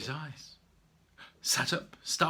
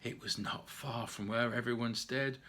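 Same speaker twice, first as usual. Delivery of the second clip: ordinary delivery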